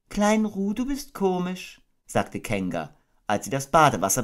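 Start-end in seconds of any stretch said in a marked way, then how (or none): 0.1-1.8 high, gentle mother kangaroo voice; motherly Kanga. Acted. Kanga tucking her baby into bed, motherly. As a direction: coo it like a doting mother.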